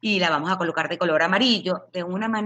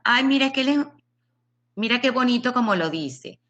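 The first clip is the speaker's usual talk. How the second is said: mock doting relative; 7 semitones higher than usual.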